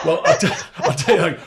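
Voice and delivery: laughing through the words; chuckling, high and loud; real laughter breaking into the start of a warning. Real, not acted. Laughing warning about kids.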